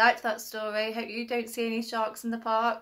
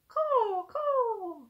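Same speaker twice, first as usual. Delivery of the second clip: crow caw; 12 semitones higher than usual. Acted. Crow revealed in the story.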